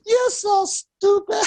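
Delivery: high, loud, slow giggling jeer; laughing taunt. Retold or imitated. Therapist teasing an aroused client, high slow laughing jeer. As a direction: drag it out through the laugh.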